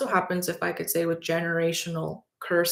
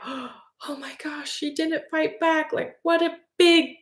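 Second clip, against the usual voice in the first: much higher, slow, amazed and delighted; thrilled celebration; 7 semitones higher, 4 dB louder, slower than usual. Retold or imitated. Teacher thrilled the student didn't fight back, delighted gush. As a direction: gasp then gush with pride. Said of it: "celebrating that kid so much".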